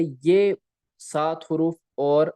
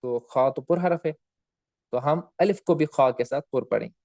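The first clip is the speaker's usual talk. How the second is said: ordinary talk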